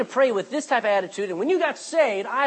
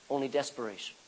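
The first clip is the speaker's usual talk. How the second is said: normal talking voice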